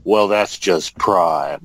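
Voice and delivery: very slow, low delivery; fan-service catchphrase, slow and deep; imagined hero's entrance line. Pretend. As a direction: drawl it out slowly and low, like a reveal from the shadows. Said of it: "walked out of the shadows and just said".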